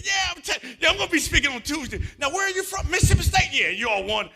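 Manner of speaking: high, loud, over-eager networking voice; hammed-up friendly keynote speaker; imitating his eager greeting. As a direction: play his bubbly self meeting strangers. Reenacting mingling with tacos.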